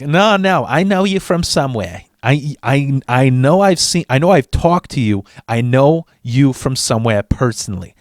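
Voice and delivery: put-on clerk's Italian-American accent; put-on Italian-American accent of a DMV lady; Italian-American lady's accent. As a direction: imitate an older Italian-American woman's accent. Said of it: "in her, in her fucking act".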